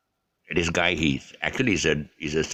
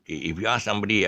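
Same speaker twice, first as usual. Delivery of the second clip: ordinary talk, nothing marked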